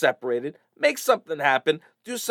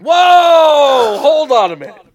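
shocked exclamation; 9 semitones higher, 12 dB louder, much slower than usual. Real, not acted. Shocked reaction to letter.

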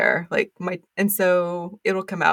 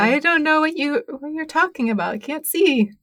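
playful mock listener; 7 semitones higher than usual. Joking as confused listener.

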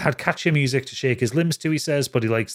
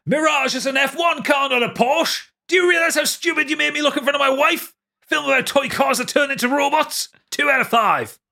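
high-pitched ranting shout; shouting in capitals; 12 semitones higher than usual. Acted. Reading out a listener's Transformers review, shouted in outraged capitals. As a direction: yell it like an all-caps message. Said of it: "He has the capitals".

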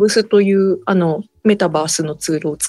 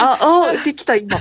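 delighted surprise, higher; suddenly realising it worked; surprised; 6 semitones higher than usual. Real, not acted.

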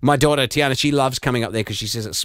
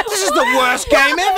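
high, louder fake sob; mock crying; 5 dB louder than usual. Host fake-crying that the game was too short. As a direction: wail it like a pretend breakdown.

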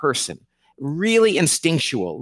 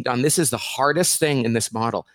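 ordinary talk, nothing marked

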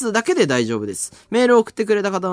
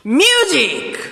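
announcer call; 10 semitones higher, 6 dB louder than usual. Acted. Radio jingle call-out.

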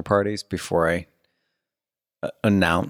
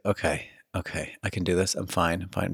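muttered self-reassurance; 2 semitones lower, 7 dB quieter than usual. Retold or imitated. Man steeling himself in strip club, quiet self-reassurance. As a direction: talk yourself down under your breath.

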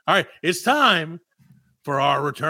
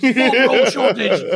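slow, louder, higher alarmist announcer; mock headline; 6 semitones higher, 6 dB louder, slower than usual. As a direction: announce it like breaking news.

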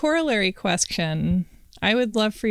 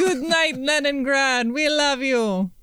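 mock Russian accent; 5 semitones higher, slower than usual. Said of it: "I don't know what accent that is".

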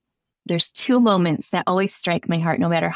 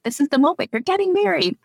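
higher, bright excited announcement; excited reminder; 10 semitones higher than usual. Retold or imitated. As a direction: pitch it up, full of joy. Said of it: "remind them, like".

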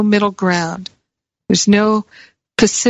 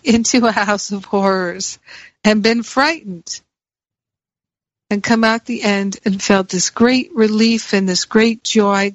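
normal talking voice

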